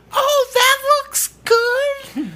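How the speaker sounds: very high, drawn-out fake praise; passive aggressive mother. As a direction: mimic a mother's sugary, loaded compliment in falsetto. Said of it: "it was a passive aggressive behind".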